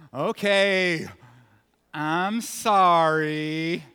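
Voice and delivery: loud, very drawn-out mock apology; sarcastic apology. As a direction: stretch it out with exaggerated insincerity. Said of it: "No, that's sarcasm".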